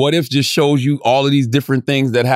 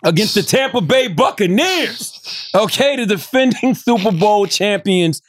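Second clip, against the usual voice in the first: excited announcer; 8 semitones higher, slower than usual. Real, not acted. Hyping his team.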